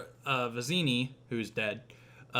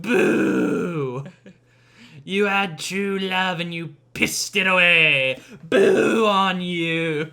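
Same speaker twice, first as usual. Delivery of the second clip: higher, much louder jeer, last line slow; jeering old woman; 5 semitones higher, 10 dB louder, much slower than usual. Acted. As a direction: scold the queen with contempt. Old woman jeers Buttercup.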